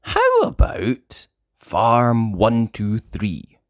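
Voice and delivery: ordinary delivery, nothing marked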